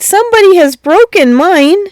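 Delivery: high squeaky cub voice; tiny bear's upset squeak. A narrator's reading. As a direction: squeak it like a hurt little cub. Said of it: "And Tiny Bear squeaked".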